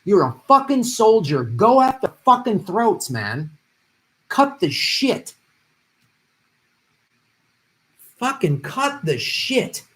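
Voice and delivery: high, drawn-out, emphatic urging; real fired-up frustration; fierce exhortation. Real, not acted. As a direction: push every word hard, slow and heated. Impassioned exhortation to a candidate.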